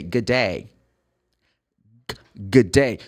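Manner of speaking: "Good day" with the D of good swallowed; dropped-D good day. Shown as a demonstration. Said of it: "They say".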